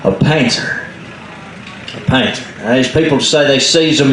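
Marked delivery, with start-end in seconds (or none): 0.0-2.4 painter said like painter. Shown as a demonstration. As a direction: say painter as old mountain folk do. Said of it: "the old people calls this".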